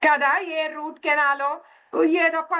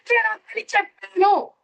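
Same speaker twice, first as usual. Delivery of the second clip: acted parental telling-off, pitched up; angry scolding mother; 4 semitones higher than usual. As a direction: scold the child like an exasperated mother. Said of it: "చేసింది అన్న కోపం ఒకటి". (కోపంగా తిడుతున్న అమ్మలా, గొంతు పెంచి)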